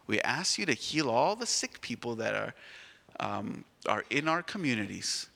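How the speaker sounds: soft, reverent closing prayer; teacher leading children in a hushed prayer. Acted. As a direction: pray quietly with the children.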